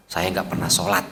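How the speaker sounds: low, soft imitated admission; hushed confession. Tough man confessing privately to the ustaz, low and hushed. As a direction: play a man quietly owning up. Acting a man's embarrassed confession.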